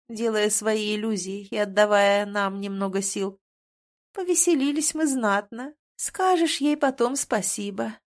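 doting grandmother, drawing phrases out; tender grandmother. Acted. Grandmother's ghost speaking in story.

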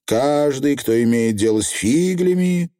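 plain reading voice

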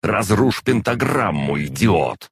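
exasperated, high-starting bark; barked order. A narrator's reading. Trapped demon barks at her clueless summoner to free her. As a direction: bark it at a slow-witted fool. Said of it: "рявкнула она".

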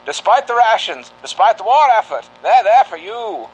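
put-on patriotic old-time radio read; 1940s radio announcer. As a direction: hail the truckers like a newsreel. Continues the radio parody.

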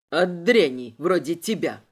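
low, contemptuous interrogator voice; low-pitched threat; menacing inspector. A narrator's reading. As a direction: throw it low at the prisoner.

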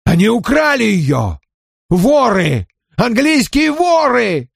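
outraged, shouting high; furious shout. A narrator's reading. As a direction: scream accusation in fury. Gentleman enraged.

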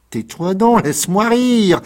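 mocking killer. A narrator's reading. Rocambole loudly mocks the old woman he strangles. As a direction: taunt her loudly while strangling.

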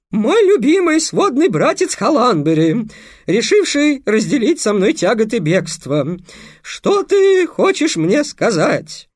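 very high, loud melodramatic falsetto; boy playing a girl in falsetto. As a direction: overact a princess in falsetto. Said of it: "патетически восклицать". (патетичный фальцет, парень играет девушку)

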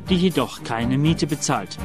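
ordinary talk, nothing marked

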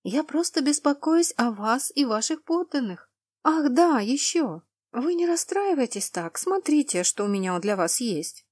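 high, sweetly smiling tease; sugary sweet. A narrator's reading. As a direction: coo it with a put-on smile. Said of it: "Я натянула самую милую улыбочку".

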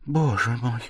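mild cry of alarm; shocked cry. A narrator's reading. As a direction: cry out in shock, only moderately loud. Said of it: "вскричала Пульхерия Александровна".

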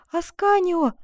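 high, loud, tender call of the name; reproachful joy. A narrator's reading. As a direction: call the beloved's name, overjoyed. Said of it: "в голосе её звучала укоризна, радость". (с радостной укоризной, громче)

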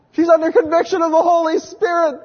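sermon climax, repeating the husband's line at full force; preaching shout. Acted. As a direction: proclaim it to the whole room.

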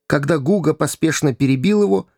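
ordinary delivery

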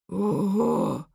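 breathless, strained wow; weak amazed gasp. Acted. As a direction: squeeze it out in awe. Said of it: "кое-как выдавил он".

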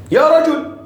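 high call to a man walking away. Pretend. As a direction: call out to get his attention. Calling to a passerby. (نداء بصوت عالٍ)

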